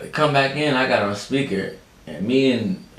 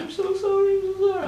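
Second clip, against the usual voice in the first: very high squeaky mock sob; imitating crying lady. Vlogger mimics the rental lady crying sorry, very high mock sob. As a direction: whimper the apology like a weepy clerk. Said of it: "she's going back and forth crying".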